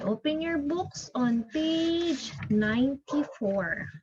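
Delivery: normal talking voice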